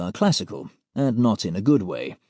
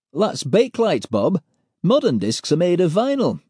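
put-on higher, know-it-all young voice; Pete lecturing; 7 semitones higher, 3 dB louder than usual. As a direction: correct Bob like a pedant.

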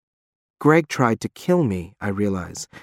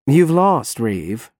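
conversational; much slower than usual. Acted. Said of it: "Fiore adds conversationally".

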